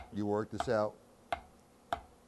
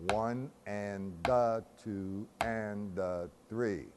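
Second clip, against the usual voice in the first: slow, evenly measured count; drawn-out rhythmic counting; breakdown count; much slower than usual. Shown as a demonstration. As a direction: count out each beat slowly, in strict time.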